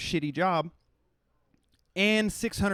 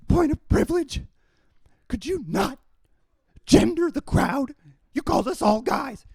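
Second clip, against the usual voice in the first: high, loud, whining mock student near tears; angry rant mocks a student's squeaky point of privilege; whiny mock outrage; 7 semitones higher, 7 dB louder than usual. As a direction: mimic an upset student's shrill complaint, high and loud. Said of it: "about to cry. He's so mad".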